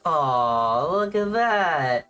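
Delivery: tender cooing. Real, not acted. Cooing over a cute shrimp.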